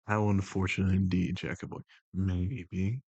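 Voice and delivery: low, measured, even put-on voice; The Voice, composed and commanding. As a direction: keep it low and level, in unhurried control. Holds The Voice.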